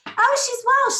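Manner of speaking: usual speaking voice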